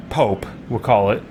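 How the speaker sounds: ordinary talk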